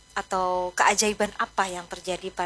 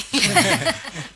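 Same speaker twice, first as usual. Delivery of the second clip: short laugh; 4 semitones higher, 4 dB louder than usual. Real, not acted. Host laughs at cave joke.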